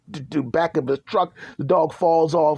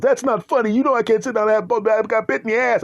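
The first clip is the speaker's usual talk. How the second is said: high, loud offended complaint; hurt and indignant, voice up; high indignant whine; 9 semitones higher, 3 dB louder than usual. Acted. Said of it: "And he goes".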